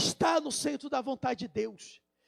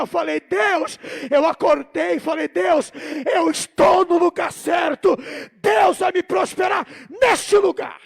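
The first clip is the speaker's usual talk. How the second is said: loud, higher-pitched defiant shout; full-force preaching; 3 semitones higher, 10 dB louder than usual. Real, not acted. Preacher retelling his cry to God about the right place, impassioned shout. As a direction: declare it at the top of the voice.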